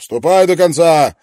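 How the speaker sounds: loud barked command; shouting. Acted. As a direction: yell it at the prisoners. Guard shouting orders. (громкий окрик)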